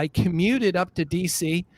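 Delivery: ordinary talk, nothing marked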